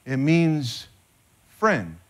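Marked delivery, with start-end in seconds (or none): none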